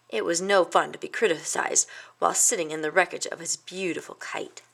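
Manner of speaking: usual speaking voice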